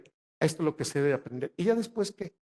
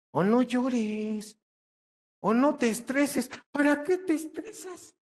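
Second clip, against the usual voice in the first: much higher, drawn-out patronizing whine; mock consoling; 8 semitones higher, slower than usual. Lecturer mocks people who dismiss a friend's tears, high whiny mock. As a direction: whine it up high like a condescending comforter. Mocking empty consoling phrases.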